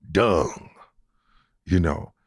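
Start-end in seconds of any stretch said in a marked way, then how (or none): 0.1-0.7 whispered word. Real, not acted. Preacher lowers voice on crude word.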